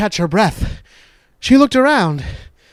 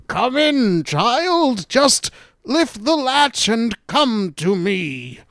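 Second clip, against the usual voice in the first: slow, higher, drawn-out disguised wolf; peculiar grandmother voice; 5 semitones higher, slower than usual. Acted. Wolf posing as grandmother calling Little Red inside, peculiar voice. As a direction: coax slowly as a wolf faking an old woman. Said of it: "the voice sounded rather peculiar".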